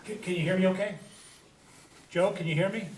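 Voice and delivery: speaking off mic, faint and distant; turned away from mic. Real, not acted. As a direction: voice not reaching the microphone. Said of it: "Just talk into the mic".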